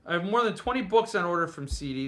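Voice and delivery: usual speaking voice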